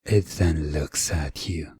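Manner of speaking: ordinary delivery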